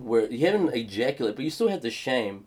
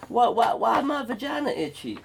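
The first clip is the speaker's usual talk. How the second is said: mock panicked nan; 10 semitones higher than usual.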